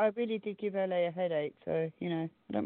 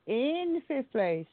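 drawn-out countdown announcement; slow announcer voice; 5 semitones higher, much slower than usual. Acted. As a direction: build suspense, slowly. Announcing ranking results.

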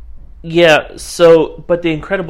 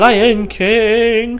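playful; 9 semitones higher, 3 dB louder than usual. Acted. Echoing choice in play.

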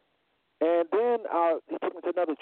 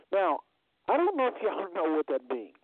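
normal talking voice

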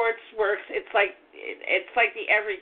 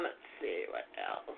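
low near-whisper, thinking aloud; muttering to herself; 15 dB quieter than usual. Real, not acted. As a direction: murmur the list under your breath. Thinking aloud mid-reading.

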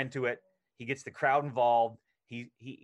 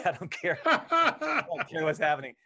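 laughing giddily; 3 semitones higher, 4 dB louder than usual. Retold or imitated. Standing next to Cruise between takes, laughing giddy delight. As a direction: giggle it out, overwhelmed with joy.

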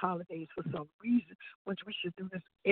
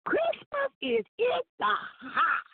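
excited laughing shout; 13 semitones higher, much slower than usual. Real, not acted. Guest cheering about Christmas arriving.

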